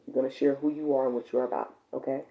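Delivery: normal talking voice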